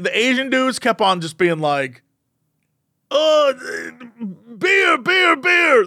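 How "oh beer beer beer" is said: put-on drunk shouting. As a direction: bellow it like a rowdy drunk at the bar. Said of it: "They just kept screaming".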